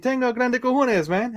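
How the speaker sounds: high, hammed-up Spanish phrase; mock Spanish. Streamer shows off his Spanish phrase, mock Spanish accent. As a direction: show off your Spanish with gusto. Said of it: "my little phrase of the day".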